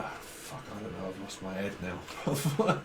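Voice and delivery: low, whispered grumble to herself; whispered frustration. Real, not acted. Having lost her thread, she whispers to herself. Losing track mid reading.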